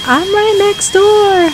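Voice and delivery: high, slow panicked neighbor voice; drawn-out, high-pitched mock wail; Pizzazz. Player voicing the neighbor next door, mock panic. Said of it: "She's like".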